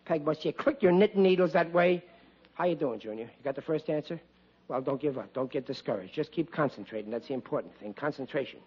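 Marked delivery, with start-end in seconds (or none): 2.5-8.6 low, soft reassurance; hushed encouragement. Acted. Father to son struggling with algebra, hushed encouragement. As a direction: coach him gently, keeping it down.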